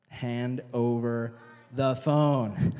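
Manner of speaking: very slow, drawn-out demand; slow stern parent. Retold or imitated. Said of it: "our parents say".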